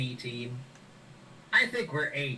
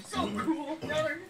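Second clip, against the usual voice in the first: much higher, goofy little brother; high character voice; 14 semitones higher than usual. Acted.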